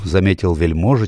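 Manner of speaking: ordinary delivery, nothing marked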